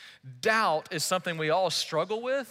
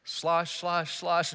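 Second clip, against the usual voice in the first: splashing footsteps; much slower than usual. Pretend. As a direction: mimic sloshing steps through water. Walking off through water.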